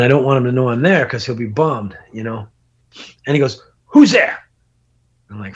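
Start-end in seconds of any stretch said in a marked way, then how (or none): 3.9-4.4 loud, high challenge, retold; startled call-out. Squatting friend hears someone nearby, retold startled shout. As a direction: imitate a sudden alarmed call.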